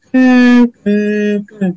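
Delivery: pluck the sound with your voice; ektara sound. Shown as a demonstration. Teacher imitating the ektara's twang for children. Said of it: "उसकी music कैसी निकलती".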